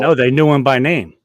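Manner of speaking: loud, incredulous correction; emphatic outburst. Real, not acted. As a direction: blurt it out, incredulous. Stressing the shocking detail.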